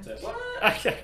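high, loud shocked squeak; surprised. Real, not acted. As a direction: blurt it out in disbelief. Surprised reaction to popcorn dislike.

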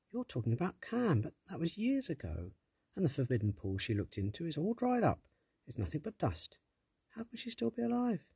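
puzzled, pushing back; young girl voice. Acted. Tess questions sprite.

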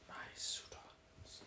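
slow, hushed alien whisper; whispering alien warlord. Acted. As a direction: whisper it like an alien master to its army. Playing the alien commanding its warriors.

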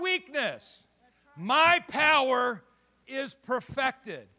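loud, high, slow proclamation; God's voice thundering out; the Father's promise. Acted. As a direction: declare it slowly from the pulpit. Voicing the Father, climax.

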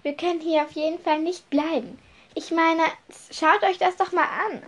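high-pitched, exclaiming appeal; urgent insistence. Acted. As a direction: urge them to look and leave. Trelawney urges everyone to leave.

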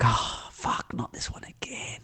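hushed, dismayed whisper to himself; cadet's private thought, barely voiced; inner-thought whisper. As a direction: whisper it like a silent groan of dread. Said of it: "in his head, of course".

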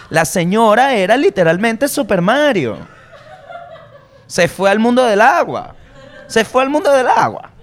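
high, laughing storytelling; cracking up while telling it. Real, not acted. Laughing at the woman falling. (contando entre risas)

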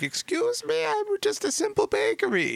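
very high, falsetto, playing dumb; squeaky innocent bakery voice. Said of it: "They're like".

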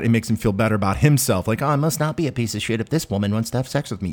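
ordinary talk, nothing marked

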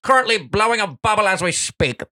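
high, hammed-up play-by-play voice; baseball announcer. Commentator introducing the batter, mock announcer voice. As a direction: call it like an excited ballpark commentator.

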